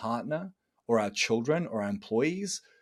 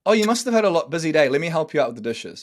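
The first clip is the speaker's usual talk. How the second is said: bright, louder caring offer; kind offer; 4 semitones higher, 6 dB louder, faster than usual. Shown as a demonstration. Husband offering his tired partner help with the dishes, caring. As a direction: sound glad to help. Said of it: "As opposed to".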